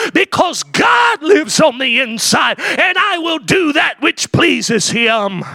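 high, ringing preacher's cry; preaching at full force. Acted. Pastor lifting the congregation, preaching at full force. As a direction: lift it up like a revival preacher.

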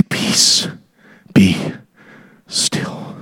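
hushed words with long pauses; whisper. Acted. Carpenter calming a panicked believer, slow whisper. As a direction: breathe each word separately, very softly.

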